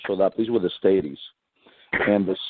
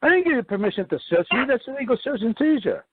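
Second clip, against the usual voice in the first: very high alarmed protest; retold protest to the cop searching pockets. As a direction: yelp it like stopping a search. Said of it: "I go".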